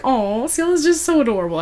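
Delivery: high-pitched fond "aw"; adoring gush. Real, not acted. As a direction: coo over someone adorable. Speaker gushes over a character.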